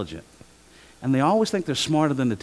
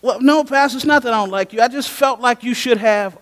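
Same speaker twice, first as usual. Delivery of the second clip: defensive congregant; 9 semitones higher, 6 dB louder than usual. Retold or imitated. Congregant answering, acted.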